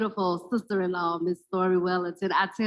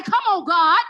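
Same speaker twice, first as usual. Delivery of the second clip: high, loud laughing cry; laughing exclamation; 10 semitones higher, 7 dB louder than usual. Real, not acted. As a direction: burst out laughing at the good news. Gleeful punchline of retold chat.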